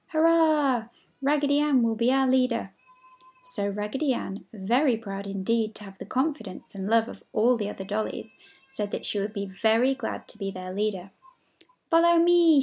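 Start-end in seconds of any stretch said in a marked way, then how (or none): 0.1-2.7 higher, louder hurrah; cheering shout. Acted. 11.9-12.6 high, loud rallying call; eager cry. Acted. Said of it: "she cried".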